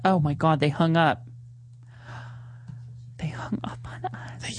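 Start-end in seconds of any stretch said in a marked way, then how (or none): 3.2-4.6 hushed, unvoiced whisper. Real, not acted. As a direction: whisper it in disbelief. Dead air after hung-up call.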